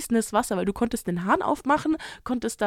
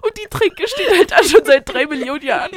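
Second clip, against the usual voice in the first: laughing; 5 semitones higher, 6 dB louder than usual. Real, not acted. Lacht beim Erzählen der Geschichte.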